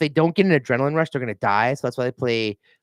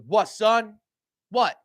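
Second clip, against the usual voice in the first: a hint of a cocky squirrel challenging him; mock tough squirrel, pitched up; 9 semitones higher, slower than usual. Driver voices squirrel staring down his truck, mock tough.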